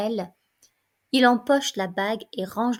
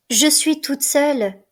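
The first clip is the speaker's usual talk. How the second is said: anguished cry; 4 semitones higher, 7 dB louder than usual. Acted. Antigone alone before death.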